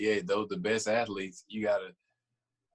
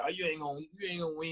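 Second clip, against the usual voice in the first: higher mocking tease; brother's taunt; 8 semitones higher, 4 dB quieter, faster than usual. Older brother taunting him after winning one-on-one, mocking and higher. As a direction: needle the little brother. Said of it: "then talk shit".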